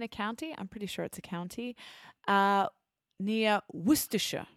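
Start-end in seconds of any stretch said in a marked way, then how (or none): 3.7-4.5 Worcestershire as "WUUS-tuh-shuh"; Worcestershire squeezed down to wuus-tuh-shuh; careful Worcestershire. Shown as a demonstration. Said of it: "I said it right for the first time".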